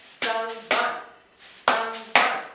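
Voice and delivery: "Sangban" loud in two split beats; loud syllable chant of "Sangban"; syllables beaten out. Shown as a demonstration. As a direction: chant "Sang-ban" with the shaker. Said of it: "Let's try that again".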